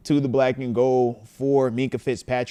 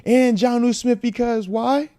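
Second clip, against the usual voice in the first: exasperated fan; 9 semitones higher than usual. Retold or imitated. Reading a fuming fan's super chat aloud, exasperated. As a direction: read the fan's rant with growing outrage. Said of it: "He says".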